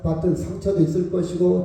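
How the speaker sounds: ordinary talk, nothing marked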